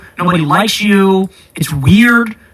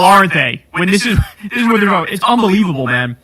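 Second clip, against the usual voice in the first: usual speaking voice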